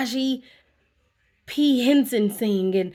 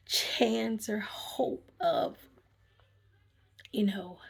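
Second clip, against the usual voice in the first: real tears; 9 dB quieter, much slower than usual. Real, not acted. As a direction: voice catching, fading to a whisper. Talking about her illness.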